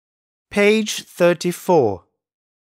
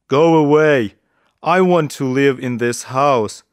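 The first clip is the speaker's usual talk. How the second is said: menacing big wolf; 4 dB louder than usual. Acted. Wolf orders rabbit and bee away.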